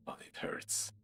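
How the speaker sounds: unvoiced, hurting whisper; pained whisper. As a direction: whimper it under your breath. Injured character complains.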